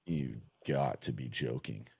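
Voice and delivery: low, slow, drawn-out incredulity; deadpan disbelief. Retold or imitated. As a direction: drop low and stretch each word, softly. Acts out stunned reaction to text.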